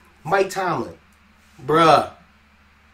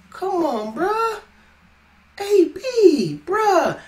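much higher, louder pleading groan; exasperated disbelief; 5 dB louder, much slower than usual. Real, not acted. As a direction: come on, really? cry it out high. Exasperated at the rapper's video.

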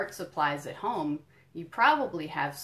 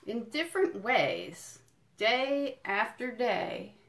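put-on reading to children; 3 semitones higher than usual. Museum educator reading a picture book to kids, storytelling. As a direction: savour each item for little listeners.

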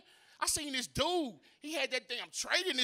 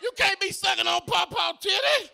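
put-on loud, startled yelp at the baby; mock shout; 5 semitones higher, 9 dB louder, slower than usual. Grandpa yelling at the baby latched onto him, loud and startled. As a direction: yell out in shock, then slow it down. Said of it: "I said".

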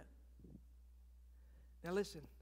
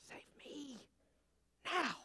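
breathy whisper, barely voiced; whispered plea; 8 semitones higher, slower than usual. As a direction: beg for rescue under your breath. Desperate plea for grace.

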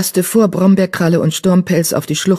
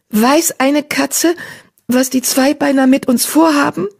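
anxious; 10 semitones higher than usual. Acted. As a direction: ask timidly, dreading the answer. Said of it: "fragte Lichtherz ängstlich".

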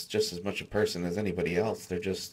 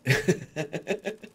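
a short, high, breathy giggle; nervous giggle; 8 semitones higher, 4 dB louder than usual. Acted. The artificer giggles at his own quip to the elf, nervous laugh. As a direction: laugh off your own joke, embarrassed.

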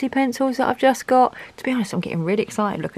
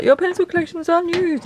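quoted critics; 10 semitones higher than usual. Retold or imitated. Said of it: "So many people ... was like".

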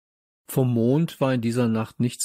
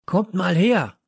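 higher, louder call to friends; 8 semitones higher, 5 dB louder than usual. A narrator's reading. Child calls two friends over in the schoolyard, calling out. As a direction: call them over from across the yard. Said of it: "rief ich ihnen zu".

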